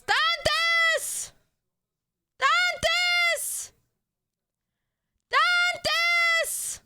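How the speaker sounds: high, far-carrying call across the house. Real, not acted. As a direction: call his name from another room. Calling out for friend.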